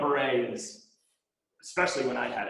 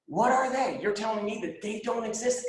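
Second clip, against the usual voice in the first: higher-pitched, incredulous questioning; challenging disbelief; 6 semitones higher than usual. Retold or imitated. Man arguing with the voice of his higher self, challenging disbelief. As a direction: demand an answer, pitched up. Retold argument with inner voice.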